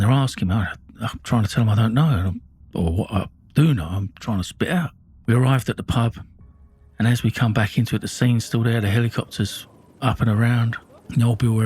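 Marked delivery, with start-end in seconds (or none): none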